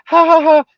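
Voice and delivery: high imitated "ha, ha, ha" of a young girl; lightly voiced girl laughing it off; mock girlish laugh. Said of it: "And then she said".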